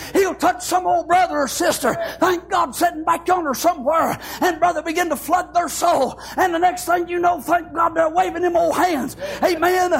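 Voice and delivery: preaching at full force. Acted. Pastor preaching to his congregation about the Holy Ghost, full-force preaching. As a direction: drive it home like a fired-up country preacher.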